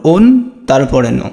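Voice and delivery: অন drawn out as "onn", then ন as "no"; slow syllable demo. Shown as a demonstration. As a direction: stretch অন, then add a short no. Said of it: "তোমরা নিজেরাই উচ্চারণ করো". (ধীরে ভেঙে উচ্চারণ, অন... নো)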